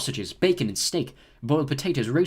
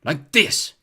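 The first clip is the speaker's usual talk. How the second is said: irritable; 4 semitones higher, 7 dB louder than usual. A narrator's reading. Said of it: "he said irritably".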